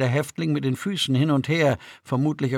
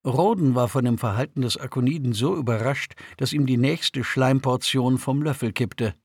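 ordinary delivery

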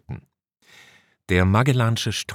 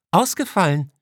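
much higher female officer voice; 11 semitones higher, 3 dB louder than usual. Acted. Officer Tanaka reports an outage to her commander, high-voiced. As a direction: answer as the officer, pitched high.

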